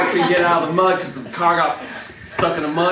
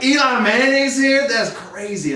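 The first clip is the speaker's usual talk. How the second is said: stoned surprise; 7 semitones higher, slower than usual. Retold or imitated. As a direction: gasp out a stoned bear's wonder. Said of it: "the bear is like".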